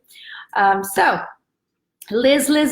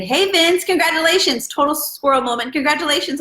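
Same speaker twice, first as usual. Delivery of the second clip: excited congratulations; 6 semitones higher than usual. Real, not acted. Greeting her nephew in the live chat, excited and higher. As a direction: cheer him on like family news just popped up.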